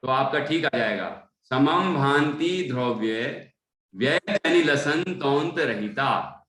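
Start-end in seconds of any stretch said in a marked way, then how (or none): 1.4-6.4 slow, careful reading of the verse line; slow model recitation. Shown as a demonstration. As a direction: say each word slowly and clearly for the student. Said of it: "इस तरह पढ़".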